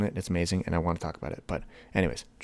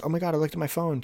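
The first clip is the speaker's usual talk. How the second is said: much higher, gushing at a text; pleased excitement; 9 semitones higher than usual. Acted. Phone owner reacting to a nice text, pleased excitement. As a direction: act out mild delight, pitched up. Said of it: "nice text".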